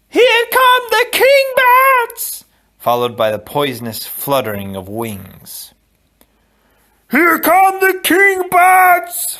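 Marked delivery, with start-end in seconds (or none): 0.1-2.4 very high, loud, drawn-out shriek; terrified scream. A narrator's reading. A mother warning of the bats, terrified scream. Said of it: "heard his mother scream". 7.1-9.4 slow, piercingly high, loud shriek; panicked scream. A narrator's reading. Fezzik frozen on the dark steps, panicked scream. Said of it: "Fezzik screamed".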